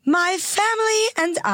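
high, vibrant take on the Queen; too joyful Queen. Acted. Co-host trying the Queen's statement, too vibrant. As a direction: read it regally with too much joy. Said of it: "Too much joy, too much vibrance".

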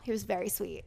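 normal talking voice

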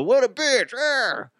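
high-pitched sympathetic outcry; a mock friend's high exclamation; mock outrage. Friends griping about wives over a smoke, mock outrage.

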